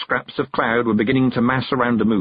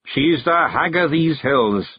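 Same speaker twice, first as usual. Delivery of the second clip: slow, higher Scots pronouncement; Scots clan chief, solemn; 7 semitones higher, slower than usual. A narrator's reading. Rob talks on, ignoring his wife, solemn. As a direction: declare it slowly with reverence.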